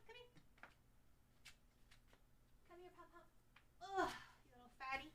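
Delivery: soft, high pet-talk; coaxing pet. Real, not acted. Streamer calling her pet Pom Pom over softly. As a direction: coax the little pet over gently.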